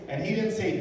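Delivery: ordinary delivery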